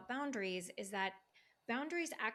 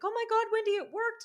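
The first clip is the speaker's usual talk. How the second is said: much higher and louder, thrilled shriek; excited squeal; 11 semitones higher, 8 dB louder, faster than usual. Retold or imitated. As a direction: squeal it like a client with great news. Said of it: "she's like".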